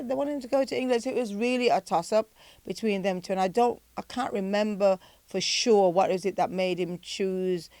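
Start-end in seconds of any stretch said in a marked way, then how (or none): none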